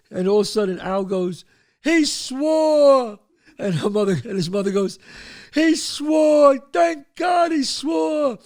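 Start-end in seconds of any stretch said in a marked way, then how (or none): 1.8-3.2 high, loud, drawn-out exclamation; triumphant cry. Retold or imitated. Father announces the oath, high loud jubilant cry. 5.5-8.4 high, loud, drawn-out cry; overjoyed mother. Retold or imitated. Mother rejoices that son swore, high relieved wailing shout.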